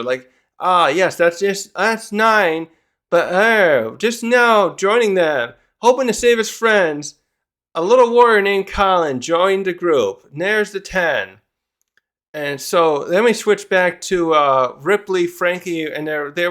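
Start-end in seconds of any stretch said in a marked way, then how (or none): 0.6-11.4 high, loud announcer voice. Acted. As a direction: call it like a live announcer. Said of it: "he's talking kinda like an announcer".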